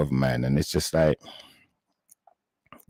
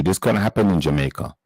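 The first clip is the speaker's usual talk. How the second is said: ordinary delivery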